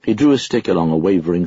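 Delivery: plain reading voice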